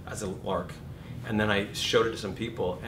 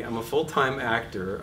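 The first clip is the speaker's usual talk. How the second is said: ordinary talk, nothing marked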